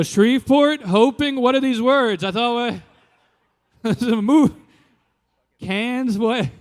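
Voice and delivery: loud, high disbelief; incredulous. Retold or imitated. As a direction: repeat his words back in shock. Incredulous reply to director.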